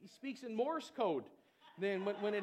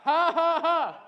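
forced laughter; 5 semitones higher, 12 dB louder, slower than usual. Shown as a demonstration. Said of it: "start laughing right now".

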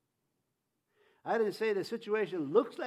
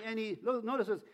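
normal talking voice

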